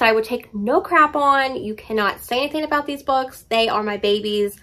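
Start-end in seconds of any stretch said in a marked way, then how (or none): none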